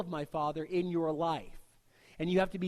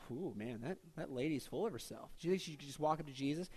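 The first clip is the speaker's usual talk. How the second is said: judgmental gossip; 2 semitones lower, 7 dB quieter than usual. Retold or imitated. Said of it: "And we go".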